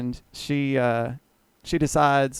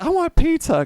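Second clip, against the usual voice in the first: put-on high whiny drunk voice, much higher; drunk whining; 14 semitones higher, 5 dB louder than usual. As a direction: mimic a drunk woman begging for pizza. Drunk woman demanding pizza.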